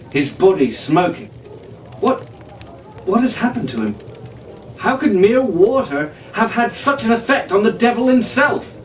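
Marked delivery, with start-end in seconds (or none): 2.0-8.7 a hint of a shaken young man demanding answers; lightly voiced demanding Fitzwilliam. A narrator's reading. Fitzwilliam demands answers of the crew, lightly voiced and urgent. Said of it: "Fitzwilliam demanded".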